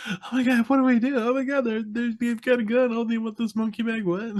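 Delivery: high-pitched frantic mock panic; full panic. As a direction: babble it in a high, panicked imitation. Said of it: "like, full panic mode".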